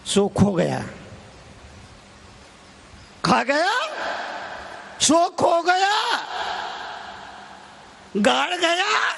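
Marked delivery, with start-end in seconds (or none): 3.2-9.1 high-pitched ringing declamation; calling out. Real, not acted. Preacher repeating a saying to the congregation, calling out. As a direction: call the saying out to the hall.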